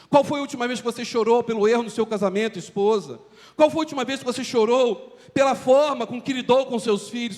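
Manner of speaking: usual speaking voice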